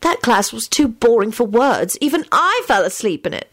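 plaintive high-pitched grumble; moaning. A narrator's reading. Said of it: "Hermione moaned".

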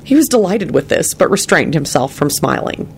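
ordinary delivery, nothing marked

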